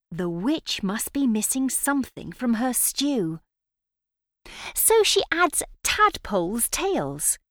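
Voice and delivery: ordinary delivery, nothing marked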